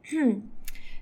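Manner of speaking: exaggerated cough to interrupt; pointed throat clear. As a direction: clear throat to break the moment. Interrupting the romantic moment. (تنحنح مقصود)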